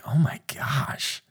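hushed gossiping whisper, slowing on "Oh my gosh"; unvoiced aside of disapproval; shocked whisper. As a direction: breathe it like a scandalized bystander.

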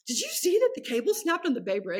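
high, excited mom voice; imitating mom. Mom phoning about the bridge, high excited imitation. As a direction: play her mother calling with news. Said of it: "my mom's calling".

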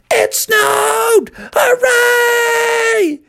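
very high, drawn-out shriek; excited scream. Acted. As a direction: scream it at the top of your pitch. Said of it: "started to scream louder and louder".

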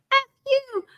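high yelled insult, imitating a protester; mock protester yell. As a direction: toss out the heckler's line high and sharp. Shouting protesters at rallies.

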